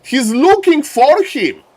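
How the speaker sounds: high, loud, slow caster exclamation; thrilled shoutcaster; excited call. Real, not acted. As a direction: shout it out as the play unfolds.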